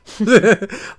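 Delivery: bright short laugh; a happy burst of laughter. Real, not acted. Laughing at his own outburst to the cohost.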